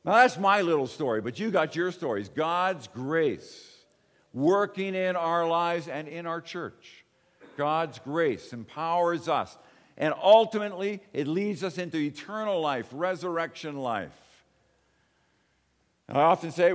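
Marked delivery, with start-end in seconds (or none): 2.3-12.9 slow sermon emphasis. Acted. Preaching on grace.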